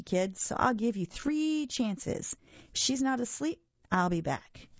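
a touch of monster bravado; scoffing monster Gabe. Acted. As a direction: play a mildly mocking monster with lively pitch.

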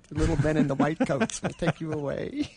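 laughing tease. Pretend. Joking threat, laughing.

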